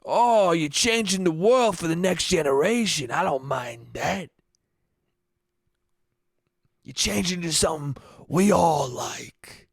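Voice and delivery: put-on slow, leering old-man voice; slow creepy Italian old-timer. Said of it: "Just a creepy fucking Italian voice".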